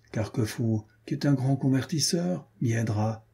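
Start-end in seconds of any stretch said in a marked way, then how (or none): none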